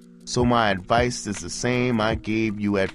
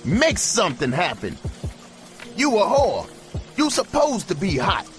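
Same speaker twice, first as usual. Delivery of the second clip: furious holler; 13 semitones higher than usual. A narrator's reading. Pimp hollers at his hooker through the window, furious. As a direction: tear into her with fury. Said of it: "he hollered".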